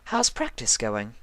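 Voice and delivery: playful. A narrator's reading. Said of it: "with a wink and a playful smile".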